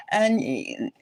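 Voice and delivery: ordinary delivery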